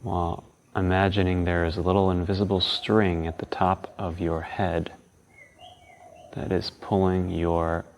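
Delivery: calm, unhurried guiding tone; guided meditation. Acted.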